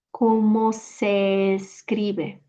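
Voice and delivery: "¿Cómo se escribe?" slowed, each syllable clear; careful slow teaching voice; slow, clear breakdown. Shown as a demonstration. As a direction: spell it out slowly for learners. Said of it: "We'll break it down afterwards".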